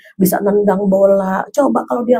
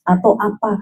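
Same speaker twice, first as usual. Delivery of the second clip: normal talking voice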